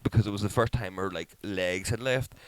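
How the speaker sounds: ordinary talk, nothing marked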